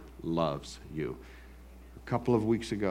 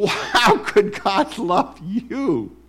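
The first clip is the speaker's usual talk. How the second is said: high, slow, sneering accuser; mocking voice of the accuser; accuser's voice; 13 semitones higher, 10 dB louder, much slower than usual. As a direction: taunt like the accuser in your head. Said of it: "There's the accuser's voice right away".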